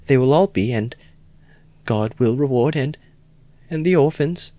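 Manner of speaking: on the verge of tears. Acted. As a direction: let her break down as she speaks. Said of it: "Sonia burst into tears".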